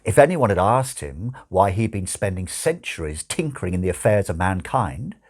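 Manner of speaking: usual speaking voice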